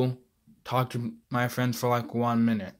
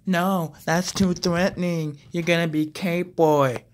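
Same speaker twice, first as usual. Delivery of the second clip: higher, louder bossy mom voice; imitating mom; 5 semitones higher, 8 dB louder than usual. Son mimicking mom vetoing his hero name, high and bossy. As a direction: lay down the law like a firm mother. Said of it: "she's like".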